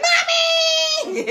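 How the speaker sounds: imitating a little girl's high shout of "Mommy!" through a laugh; daughter calling out. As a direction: call out like her excited little daughter in the audience, laughing. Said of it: "I could hear my daughter like".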